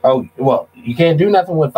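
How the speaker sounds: ordinary talk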